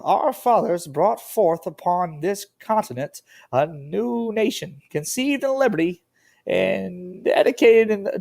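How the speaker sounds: high, slow, drawn-out presidential oratory; Abraham Lincoln. Acted. Teacher reads the Gettysburg Address to the class, put-on Lincoln voice. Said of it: "do my best Abraham Lincoln voice".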